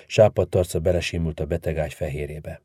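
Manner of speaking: ordinary delivery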